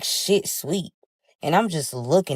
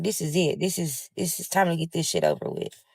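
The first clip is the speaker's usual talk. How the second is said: softer, muted talk to oneself; quiet inner voice; 8 dB quieter than usual. Retold or imitated. Inner voice voicing resigned resolve.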